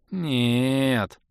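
long drawn-out no; drawled. A narrator's reading. Orbit refuses the invitation, drawling. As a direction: drag the single word out. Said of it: "протянул Орбит".

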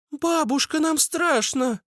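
put-on very high, slowing child voice; crying children. A narrator's reading. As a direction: whine high like scared children. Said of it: "заплакали дети". (плачущий детский голосок)

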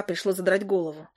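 plain reading voice

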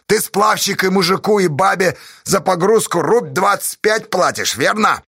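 high, loud interrogation; accusing challenge. Acted. Pozdey accusing the raftsman over pay, heated challenge. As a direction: challenge him in front of everyone.

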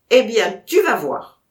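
menacing loud warning to the dog; loud threat. Acted. Vatrin threatening the pointer, loud and menacing. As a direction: promise a punishment, loud.

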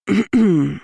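pointed cough to interrupt; throat clearing. Acted. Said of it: "раздалось неожиданно близко".

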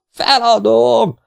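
high, drawn-out bellow. A narrator's reading. Exhausted swimmer gives up mid-pool, bellowing. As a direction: bawl out a surrender. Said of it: "bömbölt Faragó a medence közepéről".

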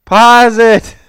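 high, loud insistent pause it; loud demand. Real, not acted. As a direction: yell it at him to pause. Pestering friend to pause.

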